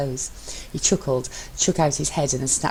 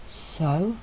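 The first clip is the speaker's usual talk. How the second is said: usual speaking voice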